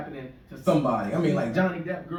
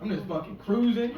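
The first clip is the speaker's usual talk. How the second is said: ordinary talk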